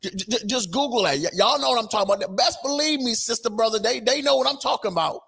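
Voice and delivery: high, hammed-up street preacher voice. Commentator mocking Crockett's new persona, high and hammed-up. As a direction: ham up the sassy pitch for laughs.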